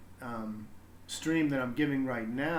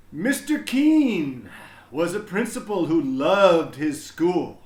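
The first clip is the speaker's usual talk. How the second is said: louder, lively storybook reading voice; reading to children; 3 semitones higher, 7 dB louder, slower than usual. Acted. Teacher reading a picture book to students, animated. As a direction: read it big and bright for the kids.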